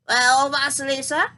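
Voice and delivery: loud, booming crone voice; roaring witch. Acted. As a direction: roar it across the hut. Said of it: "she roared".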